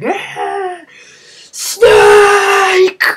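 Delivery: a mock villain screaming after his enemy; hammed-up mad villain cry; villain's yelling cry. Liquid's villain cry at Snake.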